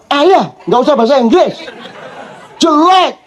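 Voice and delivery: lightly mocking child scorn; scolding child.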